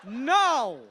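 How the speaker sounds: high, loud cry of no; drawn-out wail of refusal; anguished outburst. Acted. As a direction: yell it out in mock despair. Acts outburst back at hotel.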